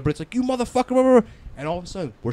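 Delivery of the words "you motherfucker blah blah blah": mock rowdy yelling. Acted rowdy banter setting tents.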